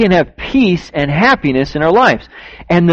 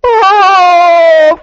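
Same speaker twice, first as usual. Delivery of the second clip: high, loud crying "Oh"; imitated crying wail; 14 semitones higher, 6 dB louder than usual. Lady at the door breaks down crying, a loud high sob. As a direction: wail it out like she's sobbing. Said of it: "The lady at the door started crying".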